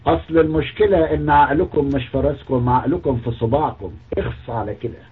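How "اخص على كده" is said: low, soft disgusted tut; disgusted tsk. Real, not acted. Scolding the prophet's words, low disgusted tut.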